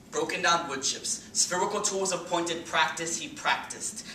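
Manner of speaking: projected poetry recital; spoken-word poem. Acted. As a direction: recite it with driving, punched rhythm.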